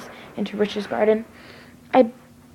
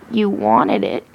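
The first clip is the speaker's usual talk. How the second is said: much lower, menacing villain voice; sinister Jeff; 11 semitones lower, slower than usual. A narrator's reading. Jeff taunting the grieving girl, low and menacing. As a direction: drop deep and threatening.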